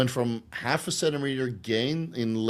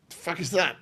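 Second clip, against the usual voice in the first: higher, shocked double take at his own arm; a jolt of mock alarm, pitched way up; startled disbelief; 8 semitones higher than usual. Waking to a numb arm, he blurts out in startled disbelief. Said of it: "You're like".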